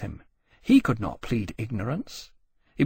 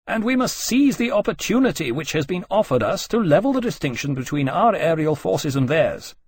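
higher-pitched, insistent argument; raised over noise; 5 semitones higher, 3 dB louder than usual. Acted. Nelson trying to reply over the noise, mildly raised voice. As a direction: press the point over the din. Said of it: "said over the noise, trying to reply".

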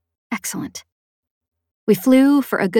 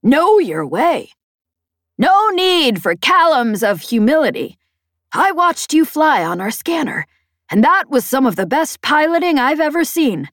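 high, emphatic comm rant; brash, exasperated Peg; 7 semitones higher, 4 dB louder than usual. Acted. Peg scoffs at the pilot's modesty, brash and high. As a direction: scoff loudly with big pitch swoops.

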